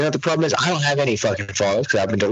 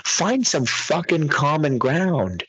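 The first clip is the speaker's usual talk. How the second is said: ordinary delivery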